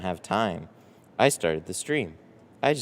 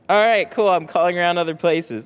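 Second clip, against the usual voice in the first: higher, louder breezy imitation of the caller; mock caller; 8 semitones higher, 7 dB louder than usual. Streamer mimics the price-shopping caller, breezy high mock. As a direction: breezily brush him off, pitched up. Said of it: "Then he's like".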